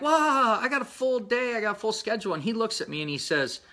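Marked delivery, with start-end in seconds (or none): 0.0-2.3 mock surprise. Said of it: "and I'm like".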